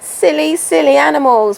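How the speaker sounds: hammed-up shout at silly horses; mock yell at horses. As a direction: pretend to yell across the field at the horses. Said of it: "screaming at them".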